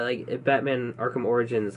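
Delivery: ordinary delivery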